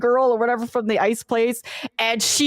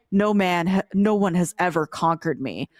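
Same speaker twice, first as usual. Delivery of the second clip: a hint of a lower, demure warrior woman; lightly voiced swooning fighter, lower; low voiced princess; 7 semitones lower than usual. Player retelling the character's acceptance, lightly voiced lower.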